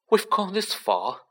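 Ron's tense high reply; tight, high and uneasy; high nervous voice. Acted. Ron answers, scared but resolved.

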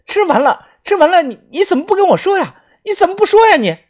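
high-pitched yell, rising as it goes; distraught, shouted reproach; frantic shout. A narrator's reading. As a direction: scream it in rapid, accusing bursts. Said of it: "大喊着说道".

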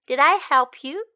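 ordinary talk, nothing marked

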